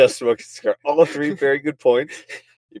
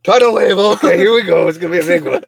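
hyped up; 8 semitones higher, 6 dB louder than usual. Pretend. Mock hyping a big wave.

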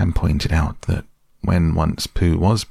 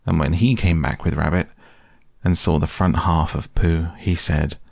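plain reading voice

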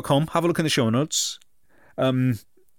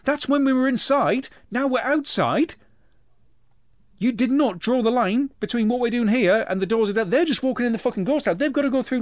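high outraged complaint; high whiny child rant; indignant kid; 9 semitones higher than usual. Retold or imitated. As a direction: rant like a kid demanding the manager. Kid ranting at the Sentinel.

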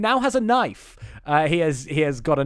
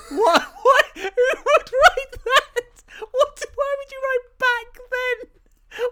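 high squealing laugh-cry of outrage; cracked-up incredulous squeal; laughing disbelief; 4 dB louder, slower than usual. Real, not acted. Outraged disbelief at movie line.

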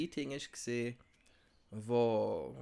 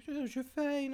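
high sing-song praise; dog praise voice; 13 semitones higher than usual. Shown as a demonstration. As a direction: talk high and sweet to a dog. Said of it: "weil Frauen höher reden".

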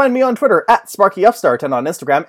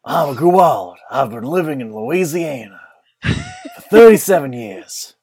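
mock alien vampire; 3 dB louder than usual. Playing alien vampire character.

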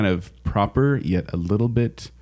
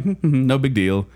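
lightly imitating an effortless walker, humming along; breezy, slightly higher mimicked unconcern; mock nonchalance; 4 semitones higher than usual. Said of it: "she's just walking by".

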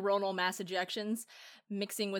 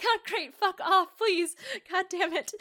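higher-pitched mock despair; acted exasperation; 8 semitones higher, 4 dB louder, slower than usual. Imitating a character secretly fed up with Daniel, exasperated. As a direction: plead through put-on frustration.

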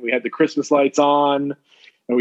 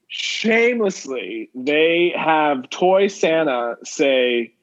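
usual speaking voice

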